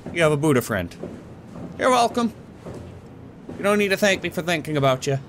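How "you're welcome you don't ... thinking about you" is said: high, sweetly smug; mock gracious. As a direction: play the generous host. Grandly granting statue a gift.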